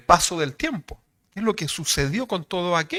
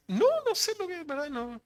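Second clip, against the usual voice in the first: confused sister; 12 semitones higher, 6 dB quieter than usual. Retold or imitated. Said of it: "¿y usted entiende lo que dice?".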